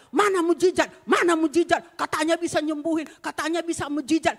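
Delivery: loud, high demanding yell; angry accusation. Retold or imitated. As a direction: throw the accusation at the one who prayed. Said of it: "Datang anaknya marah-marah sama saya". (tuduhan marah dengan suara keras dan tinggi)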